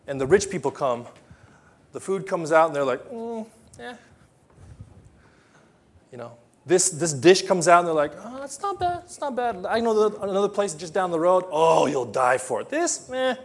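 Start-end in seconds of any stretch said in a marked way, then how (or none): 3.1-3.9 high, unimpressed little grunt; bored rich guest. Retold or imitated. Rich guest shrugging off the food, high and bored. 8.2-13.3 higher, dismissive and unimpressed; snooty rich guest. Retold or imitated. Rich guest at a banquet, snooty and bored.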